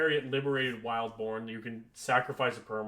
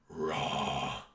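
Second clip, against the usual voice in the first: low, drawn-out goofy roar; stupid voice; 10 semitones lower than usual. Pretend. Bored streamer clowning for listeners, stupid voice. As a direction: put on a silly deep growl of "raw". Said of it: "we're done with the stupid voice".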